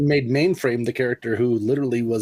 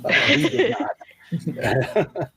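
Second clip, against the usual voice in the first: slow words through a laugh; chuckling while admitting it; 8 semitones higher, much slower than usual. Real, not acted. Joking admission, laughing.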